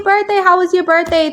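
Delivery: high, chirpy birthday greeting; cheery wishes. Retold or imitated. Host imitating viewers' birthday wishes, high and chirpy. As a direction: gush a quick happy birthday.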